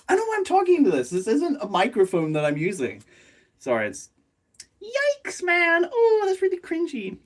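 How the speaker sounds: very high, fast squeak; mock-cringe squeak; squeaky mock voice. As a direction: squeak it like a cartoon. Mocking herself in squeaky voice.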